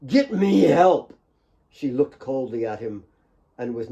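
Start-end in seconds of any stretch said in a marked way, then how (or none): 0.1-1.1 higher, louder, slow begging; desperate plea. Acted. Dying Nick begging Claire for help, slow and desperate. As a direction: beg for your life.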